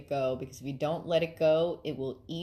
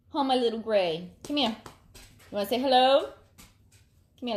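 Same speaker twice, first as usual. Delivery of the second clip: higher, sing-song coaxing voice for the pet; pet talk; 5 semitones higher, 3 dB louder than usual. Real, not acted. Calling her little pet over to say hello, in high coaxing pet talk. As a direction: coo to the pet to come over.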